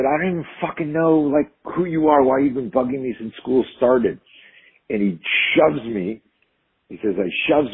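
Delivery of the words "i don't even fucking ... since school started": higher-pitched, heated comeback; indignant retelling, pitch raised; retold angry retort. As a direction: act out a fed-up kid snapping back. Retold angry confrontation at school.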